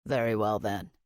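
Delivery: low, soft, calm male voice; low Rockruss voice. A narrator's reading. Rockruss character voice.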